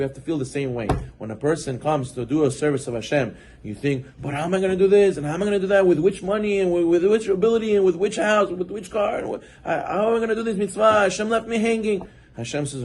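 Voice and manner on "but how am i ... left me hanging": higher-pitched fretful questions piling up; anxious fretting. Retold or imitated. Person doubting Hashem's help, pitched-up anxious voice. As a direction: fret aloud, voice lifted and anxious.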